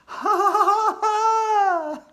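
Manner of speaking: very high, loud witchy cackle; eerie cackling laugh. Acted. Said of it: "She let out an eerie, cackling laugh".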